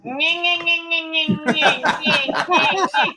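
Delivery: high, straining mechanical whine; whining plane noise. Retold or imitated. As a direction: imitate a plane struggling backwards, nyeh-nyeh. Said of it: "This is the noise the plane made".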